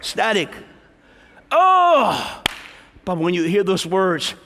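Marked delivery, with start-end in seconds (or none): 1.5-2.4 high, loud sudden oh; a stunned cry at bad news; shocked gasp. Preacher voicing a heartbroken listener, sudden shocked oh.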